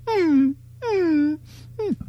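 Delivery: high, thin closed-mouth dog whimper; imitated puppy whine. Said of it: "all of a sudden you hear".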